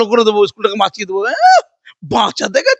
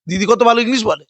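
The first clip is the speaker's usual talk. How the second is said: usual speaking voice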